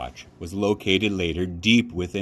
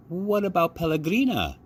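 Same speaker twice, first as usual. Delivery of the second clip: put-on child voice; 10 semitones higher than usual. A narrator's reading. Child asks at dinner.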